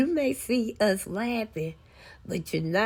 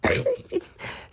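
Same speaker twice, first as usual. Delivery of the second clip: laugh. Real, not acted. Laughing at the memory.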